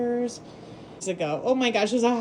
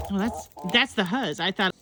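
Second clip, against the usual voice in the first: ordinary delivery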